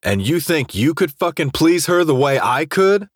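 high-pitched, scornful question rising at the end; sneering challenge. A narrator's reading. Jace taunts his rival about the girl, sneering. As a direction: challenge him with a curled lip. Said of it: "I asked, curling my lip".

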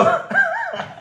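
shrieking laugh at the punchline; loud high laugh; high burst of laughter. Real, not acted. Laughs at own joke.

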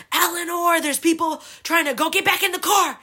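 high, loud urgent yelling; screaming dad. Retold or imitated. Dad yelling at his daughter on the sidewalk, acted screaming. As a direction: yell orders like a furious parent, retold. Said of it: "my dad's screaming at me".

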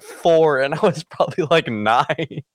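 ordinary talk

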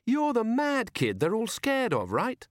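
high, slow, sugary taunt; mock sweetness. A narrator's reading. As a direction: needle them sweetly, rising at the end. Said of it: "as sweetly as she could".

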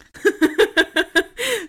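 high bursting giggle; kind gentle laugh. Real, not acted. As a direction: laugh out loud, helplessly. Laughing at dogs playing.

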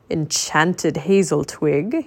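surprised. Acted. Merlin questions Teddy's words.

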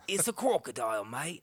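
put-on Australian accent croc-hunter; broad mock Australian wildlife host; Steve Irwin. Host impersonates Australian naturalist spotting a crocodile, broad Australian accent. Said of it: "Steve Irwin".

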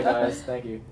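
ordinary talk

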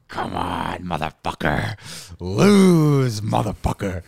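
New York attorney general gloating over a case; gloating prosecutor. Retold or imitated. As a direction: voice a prosecutor itching for him to lose. Voicing the eager attorney general.

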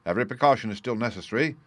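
ordinary delivery, nothing marked

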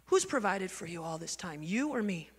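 low, soft fatherly questioning; gently corner them with a quiet question; fatherly Lord. Retold or imitated. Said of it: "He speaks to me like my dad".

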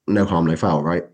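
ordinary talk, nothing marked

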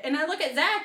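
ordinary delivery

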